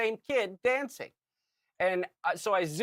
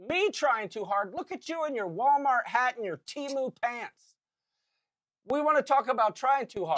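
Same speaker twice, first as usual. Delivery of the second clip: mock yell; 5 semitones higher, 5 dB louder than usual. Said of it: "when I was yelling at this kid".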